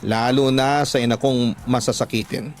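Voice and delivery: ordinary talk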